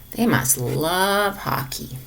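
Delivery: ordinary delivery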